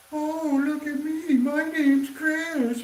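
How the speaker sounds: high, very slow mock voice; mocking impression. Imitating Jordy's mocking impression of himself, high and slow. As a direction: parody yourself the way a friend would. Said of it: "That's gonna be the Jordy making fun of me".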